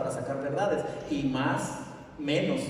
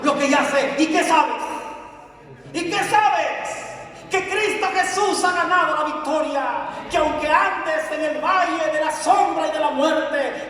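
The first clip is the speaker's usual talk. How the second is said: loud, higher, driving sermon; fiery preaching; 13 semitones higher, 9 dB louder than usual. Real, not acted. Preacher exhorting the congregation, fiery preaching. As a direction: preach it to the crowd.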